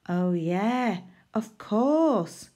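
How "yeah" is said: usual speaking voice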